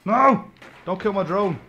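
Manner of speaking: alarmed shout. Real, not acted. Drone under fire.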